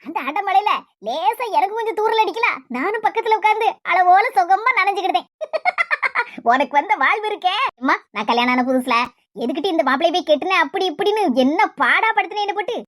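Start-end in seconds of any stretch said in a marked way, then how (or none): 5.3-6.5 gleeful laugh. Real, not acted. Woman laughs after sipping soup.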